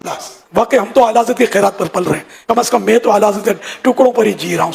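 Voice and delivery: usual speaking voice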